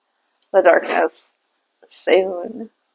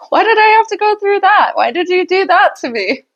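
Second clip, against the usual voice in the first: mock whiny complaint; 10 semitones higher than usual. Mock complaint at laughing spirits.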